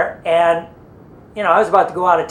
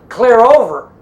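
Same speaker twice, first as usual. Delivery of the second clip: loud, raised callout in unison style; retold call-out; 5 semitones higher, 7 dB louder, slower than usual. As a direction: call the all-clear. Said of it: "they go".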